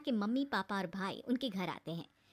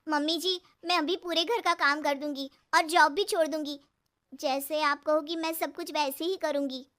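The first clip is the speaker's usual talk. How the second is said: new bride eagerly promising, higher and louder; nervous, over-eager young bride; fearfully; 5 semitones higher, 6 dB louder than usual. Acted. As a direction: rush out promises to the mother-in-law in fear. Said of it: "डरते हुए बोलती है".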